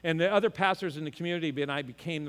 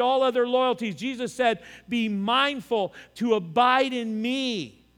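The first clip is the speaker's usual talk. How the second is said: raised, driving sermon voice, slowing at the end; preaching; 6 semitones higher, 5 dB louder than usual. Acted. Pastor drives home loyalty to the congregation, preaching. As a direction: press the point home from the pulpit.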